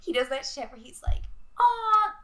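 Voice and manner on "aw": high, loud mock-child complaint; whiny kid. Boy changes his mind about the dog's name, kid whine. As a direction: imitate a small boy's slow, disappointed protest. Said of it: "very kid fashion".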